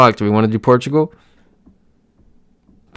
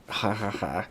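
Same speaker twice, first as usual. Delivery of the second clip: soft, deadpan "ha, ha, ha"; spaced, half-hearted laugh at a tease; dry mock laugh; 12 dB quieter than usual. Real, not acted. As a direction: laugh quietly without real amusement. Dry reply to viewer's teasing.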